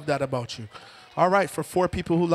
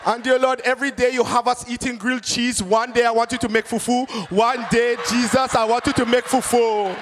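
put-on fervent Ghanaian-accented prayer; hammed-up, forceful Ghanaian preacher; Kwesi from Ghana; 3 semitones higher than usual. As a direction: implore the Lord in a Ghanaian accent. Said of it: "someone from Ghana pray".

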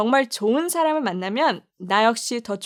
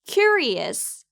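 "Curious" with a high, strong "kyu" at the start; stress on CU; 4 semitones higher than usual. Shown as a demonstration. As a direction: punch the first syllable of "Curious", let the rest fall. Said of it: "Q를 좀 강하게 발음하세요". ("Curious"의 '큐'를 높고 강하게)

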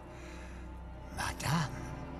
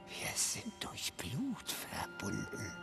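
sinister whisper; 10 semitones lower, 7 dB quieter than usual. Acted. Villain whispers to boy.

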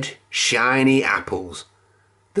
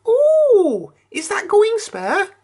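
very high, louder squeaky piggy voice; Pig eager; 3 dB louder, slower than usual. A narrator's reading. Pig eyeing the last sandwich, very high and eager. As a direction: coo it like a greedy little pig.

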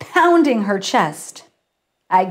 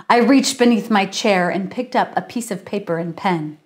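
ordinary delivery, nothing marked

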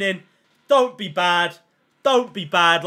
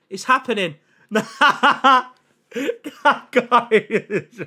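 breaks into a laugh mid-line; slower than usual. Real, not acted. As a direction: laugh it off, can't hold it in. Laughing at roulette result.

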